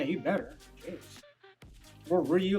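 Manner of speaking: ordinary delivery, nothing marked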